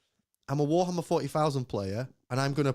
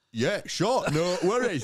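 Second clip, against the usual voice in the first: higher, mock northern English accent; imitated northern accent; 3 semitones higher, slower than usual. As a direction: readily agree in a hammed-up northern accent. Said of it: "Can you do a Northern accent?".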